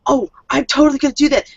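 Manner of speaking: high, rushed eager yes; ecstatic. Acted. Kay accepts the kitten as drinking buddy, ecstatic. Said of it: "she gets so ecstatic".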